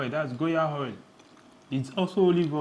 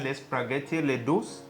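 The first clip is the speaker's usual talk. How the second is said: made-up Italian in a put-on accent; mock Italian; slower than usual. Partner asks for Italian for the dish, badly faked Italian accent. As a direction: fake your way through Italian food words. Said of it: "Say in Italian now".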